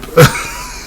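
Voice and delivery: short squeal of laughter; high laugh. Real, not acted. Vlogger squeals with laughter at his own joke. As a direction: let out a quick squeal.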